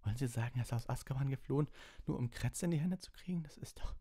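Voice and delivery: put-on high, rising boyish disbelief; flustered, high-pitched questioning; incredulous protest. A narrator's reading. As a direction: protest in a squeaky, rising pitch, trailing away.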